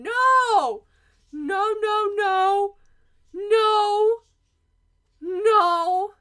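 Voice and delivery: high, loud wailed refusals; grieving denial. Acted. Student refuses to accept the death, grieving denial. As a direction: keep crying out in protest.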